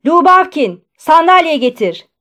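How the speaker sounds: high, loud shouted order; sudden bellow. A narrator's reading. Postmaster ordering a clerk to fetch a chair, bellowing. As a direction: call out sharply to a subordinate. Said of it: "öyle bir bağırdı ki".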